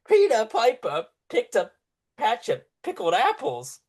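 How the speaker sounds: guard parroting the line high and loud; mocking guard. Guard repeats the prisoner's words back, mocking high and loud. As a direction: mimic him in a high, jeering voice. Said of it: "He just starts laughing".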